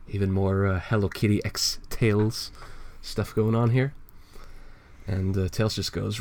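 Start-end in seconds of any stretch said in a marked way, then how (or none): none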